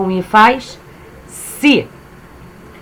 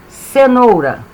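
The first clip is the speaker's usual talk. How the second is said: ordinary talk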